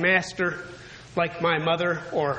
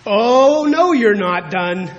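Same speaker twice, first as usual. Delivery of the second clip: a parent firmly refusing the finished job; emphatic; 2 semitones higher, 8 dB louder, slower than usual. Retold or imitated. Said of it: "told us em-, emphatically".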